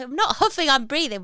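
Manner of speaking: loud defensive protest; indignant protest. Retold or imitated. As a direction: insist loudly you're fine. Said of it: "It's like".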